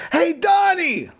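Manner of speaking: high, drawn-out mock holler; calling out. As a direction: imitate hailing someone across the room. Retold girl calling across room.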